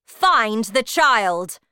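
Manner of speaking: loud, slow witch's order; commanding shout. A narrator's reading. Grand High Witch orders her witches, slow commanding shout. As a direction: shout it slowly as the head witch. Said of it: "shouted the Grand High Witch".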